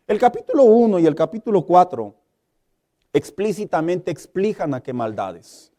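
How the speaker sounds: ordinary talk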